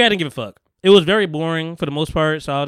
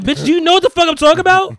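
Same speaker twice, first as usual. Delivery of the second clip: much higher, louder exasperated snap; mock furious outburst; 12 semitones higher, 7 dB louder than usual. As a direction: blow up at the half-asleep agent. Retold rant at sleepy agent.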